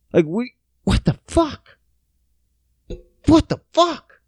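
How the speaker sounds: rising disbelief, climbing high; exasperated cry of disbelief; high baffled what the fuck; baffled outburst. Real, not acted.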